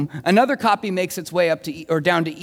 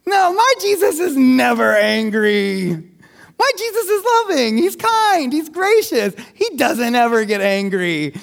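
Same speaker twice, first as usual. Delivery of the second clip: mock protesting scribe; 3 semitones higher, 4 dB louder than usual. Preacher plays scribe defending his gentle Jesus, mock protesting. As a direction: put on a protesting voice, stretched and raised.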